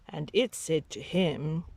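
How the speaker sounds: ordinary delivery, nothing marked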